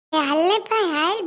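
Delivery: loud call across the house; shouting a summons. Acted. Calling out to friend.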